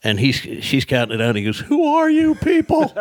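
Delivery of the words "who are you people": very high, drawn-out disbelief; astonished mock outcry. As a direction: imitate a flabbergasted guard, voice shooting up. Guard astonished as thousands are counted out.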